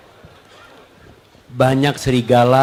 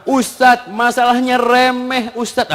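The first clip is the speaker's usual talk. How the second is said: high, slow imitation of a complaint; complaining woman; 8 semitones higher than usual. Wife confiding in the ustadz, high drawn-out complaint. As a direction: mimic a woman pouring out a petty grievance. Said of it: "sering dapat curhatan perempuan".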